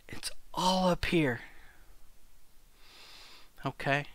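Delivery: hushed, breathy whisper of a secret. Acted. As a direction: lean in and whisper it. Whispering a point to audience.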